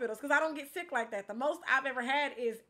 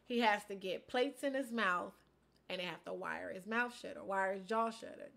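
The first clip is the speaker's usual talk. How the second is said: normal talking voice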